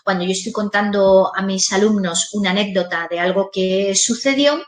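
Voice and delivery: ordinary delivery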